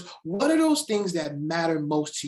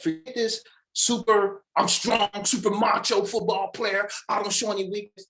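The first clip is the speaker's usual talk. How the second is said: mock macho player; 6 semitones higher than usual. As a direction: lightly puff up the macho act. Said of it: "I said".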